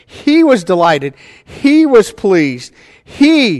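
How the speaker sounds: HE hit loud and drawn out each time; stress on HE. Shown as a demonstration. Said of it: "being first in the sentence, you should say".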